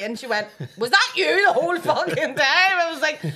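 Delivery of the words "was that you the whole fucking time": high, loud incredulous outburst; mock-outraged shout at a friend; imitated outraged disbelief. Friend discovering the prank, imitated loud disbelief.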